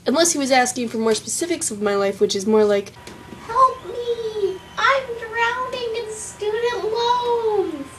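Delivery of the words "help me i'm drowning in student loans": very high, drawn-out pretend wail; high mock-desperate plea; mock cry for help. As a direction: wail for help like you're drowning, hammed up.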